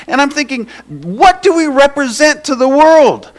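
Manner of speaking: loud, high, impassioned preaching. Real, not acted. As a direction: pour your heart into the sermon, high and loud. Preaching at full force.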